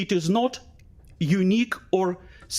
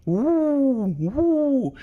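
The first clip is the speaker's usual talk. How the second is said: high wailing siren sound, woo woo; high, far-off siren wail imitated; imitated air-raid siren; 9 semitones higher than usual. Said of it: "I actually heard the banshee".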